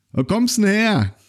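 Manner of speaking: high, slow curious Berliner; pub regular. Retold or imitated. Pub regular asks the stranger where he's from, high and slow. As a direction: ask it like a nosy local. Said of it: "irgendeiner von den Typen gesagt".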